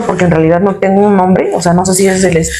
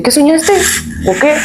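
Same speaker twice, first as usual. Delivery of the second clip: higher, curious uncle voice; uncle asking; 8 semitones higher than usual. Retold or imitated. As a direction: ask about the dream, pitched up. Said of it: "y me dice".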